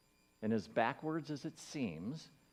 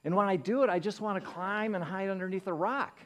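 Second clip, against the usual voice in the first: higher, louder burst of embarrassment; embarrassed outburst; 8 semitones higher, 5 dB louder than usual. Real, not acted. Admitting to the congregation, embarrassed and raised. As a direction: let the shame spill out. Confessing embarrassment, loud high.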